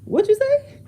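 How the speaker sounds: stunned, daring him to repeat it; incredulous what. Retold or imitated. Mom's shocked reply to son.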